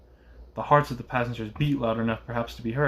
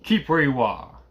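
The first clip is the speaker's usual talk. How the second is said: raised voice of a guard; calling out; 2 semitones higher, 4 dB louder than usual. A narrator's reading. Guard to the voice in the mist, calling out. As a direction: call a firm order across distance. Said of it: "the guard called to the voice in the mist".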